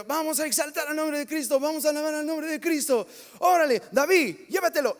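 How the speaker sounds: rally the church, then hand off to David; worship leader hype. Retold or imitated. Worship director opening the service, excited loud call-out.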